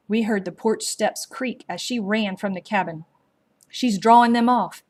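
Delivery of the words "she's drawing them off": usual speaking voice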